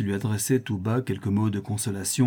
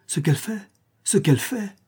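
exasperated echo, rising higher; mounting anger; 7 semitones higher than usual. A narrator's reading. Countess exasperated by her curious husband, mounting anger. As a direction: repeat the question, climbing in outrage. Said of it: "la colère allait toujours croissant".

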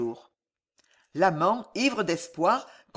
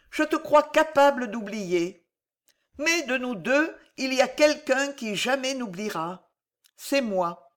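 higher, earnest female voice; Alice's grave answer; 7 semitones higher, 3 dB louder than usual. A narrator's reading. Alice telling the monk she will never forget, grave. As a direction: confess it sadly but firmly.

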